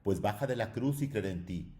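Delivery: grito agudo y fuerte; lánzale el grito desde abajo; grito burlón. A narrator's reading. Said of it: "gritaba otro". (un hombre de la plebe le grita a Jesús con burla)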